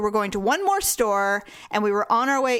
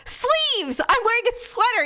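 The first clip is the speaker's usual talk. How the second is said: indignant explaining; 11 semitones higher than usual. Retold or imitated. Explaining her zombie arms.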